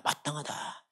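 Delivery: a hushed slow whisper to let the word sink in; drawn-out whisper for emphasis. Shown as a demonstration. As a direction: breathe the word out slowly, barely voiced. Repeats the word, hushed and slowed.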